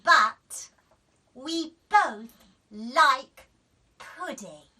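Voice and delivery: slow, loud gotcha; triumphant child. A narrator's reading. Daisy explaining to mum, triumphant. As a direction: spell it out smugly. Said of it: "said Daisy".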